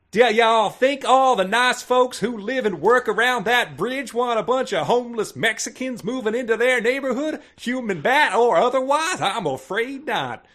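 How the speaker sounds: hammed-up Texas accent, like a sweet Southern lady. Reading Elena's line to Opal in a hammy Texas accent. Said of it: "this is in a Texas accent".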